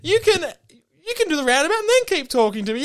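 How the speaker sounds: high, laughing, then rushing; a brother talking his frazzled sister round, laughing; amused reassurance. Retold or imitated. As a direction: coax her along while giggling. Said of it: "And I'm like".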